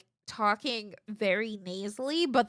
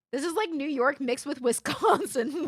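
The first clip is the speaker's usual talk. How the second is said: ordinary delivery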